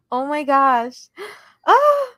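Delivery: ordinary delivery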